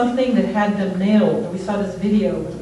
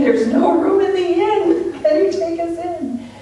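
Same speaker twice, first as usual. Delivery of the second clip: laughing plea; 8 semitones higher than usual. Retold or imitated. Retelling a plea on the phone.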